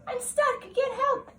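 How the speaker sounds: put-on small girl stuck in a tree, high and calling; stuck child calling.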